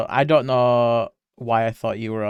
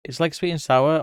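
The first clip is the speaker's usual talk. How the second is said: normal talking voice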